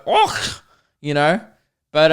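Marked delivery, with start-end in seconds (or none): 0.0-0.6 high guttural throat sound; exaggerated high-pitched German "och"; mock German noise. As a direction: imitate harsh German with one throaty noise. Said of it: "German's very, like".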